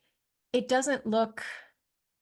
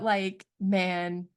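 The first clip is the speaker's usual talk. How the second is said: normal talking voice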